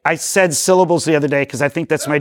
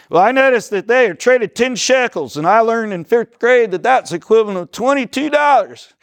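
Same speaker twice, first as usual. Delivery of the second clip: higher-pitched imitation of a teammate; Bart; 4 semitones higher than usual. Teacher mimics teammate reporting a highlight, put-on know-it-all student voice. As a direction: recite the trivia in a raised put-on voice. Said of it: "That was my Bart accent".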